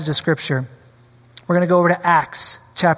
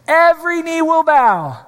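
rising preaching shout; 9 semitones higher than usual. Real, not acted. Preacher peaks on repeated line.

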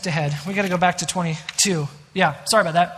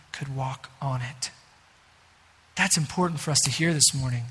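hushed emphasis; 6 semitones lower, 6 dB quieter than usual. Real, not acted. Sermon climax, hushed.

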